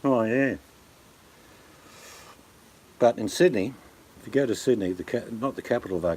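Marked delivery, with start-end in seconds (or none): 0.0-0.6 real nasally Victorian twang, raised pitch; nasal Aussie drawl; nasal southern Victorian. Shown as a demonstration. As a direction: push it through the nose like a Victorian. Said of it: "They've got that real nasally".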